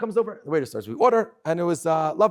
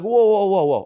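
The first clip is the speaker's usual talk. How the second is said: loud, stunned whoa, whoa; amazed whoa; 3 semitones higher, 8 dB louder than usual. Retold or imitated. Retelling his reaction to the waiter's story, a loud amazed whoa. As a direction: blurt it out, floored by what he just heard.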